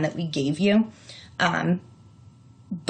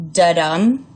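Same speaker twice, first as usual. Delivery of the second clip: "da-dum" with a louder second beat; iamb beat; 5 dB louder than usual. Shown as a demonstration. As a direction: punch the second syllable of "da-dum". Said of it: "is that".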